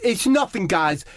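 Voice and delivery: high, brave-faced Harry Kane voice; Harry Kane rallying his teammates, high and brave-faced; Harry Kane impression. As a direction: shrug it off bravely. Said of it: "He says".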